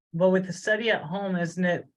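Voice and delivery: normal talking voice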